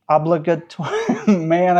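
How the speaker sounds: breaking into a laugh mid-word; laughing at own pronunciation. Real, not acted. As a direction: crack up while stumbling over the word. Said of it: "I can't even say that word".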